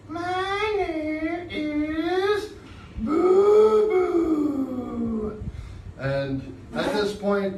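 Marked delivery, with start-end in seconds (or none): 0.1-5.4 very high, slow, timid puppet voice; shyly telling his name. Acted. Shy puppet Boo Boo introducing himself to the class, high and timid. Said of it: "introverted and shy".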